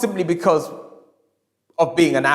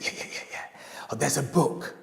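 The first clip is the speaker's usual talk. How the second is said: murmured whisper; 3 semitones lower, 11 dB quieter than usual. Retold or imitated. Shy customer murmuring.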